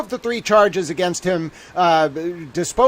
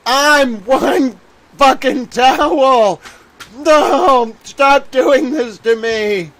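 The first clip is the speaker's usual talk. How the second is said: high, drawn-out whine of despair; mock anguished wail; 5 semitones higher, slower than usual. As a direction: ham up the breakdown, pleading to the sky. Mock meltdown over lunch.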